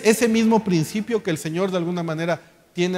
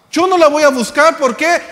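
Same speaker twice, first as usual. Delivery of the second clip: quicker, higher, indignant repeat of "Yo no la voy a buscar"; indignant spouse; 6 semitones higher, 3 dB louder, faster than usual. Retold or imitated. Preacher mimics an offended spouse refusing to reconcile, indignant. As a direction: throw it back higher and faster, like an offended spouse. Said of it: "esto es muy común entre los matrimonios".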